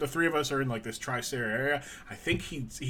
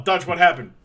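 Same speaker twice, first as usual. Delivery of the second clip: loud mock question; 3 semitones higher, 9 dB louder than usual. Voicing booker asking Dutch.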